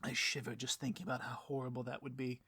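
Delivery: ordinary talk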